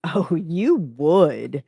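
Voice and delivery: slow, drawn-out gloating sneer; gleeful sneer. A narrator's reading. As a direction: savor the jab, stretching the words. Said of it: "Drain sneered gleefully".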